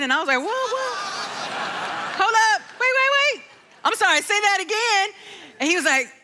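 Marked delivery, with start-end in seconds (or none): 0.4-5.1 high-pitched, halting disbelief; startled double-take, pitched way up; stunned alarm. Retold or imitated. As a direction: stop them short, voice jumping up high. Mother stunned by brother's news.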